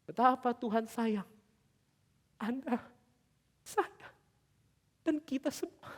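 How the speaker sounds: soft, high, choked-up voice; halting words, holding back real tears; close to tears. Real, not acted. Preacher moved by God's love for everyone, tearful voice. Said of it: "mau nangis".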